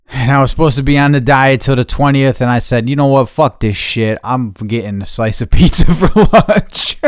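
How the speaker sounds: loud, laughing confession; excited confession. Real, not acted. Confessing to viewers he broke his diet. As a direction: gleefully admit breaking the diet.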